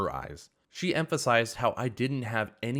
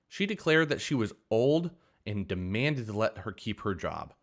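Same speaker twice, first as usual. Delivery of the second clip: usual speaking voice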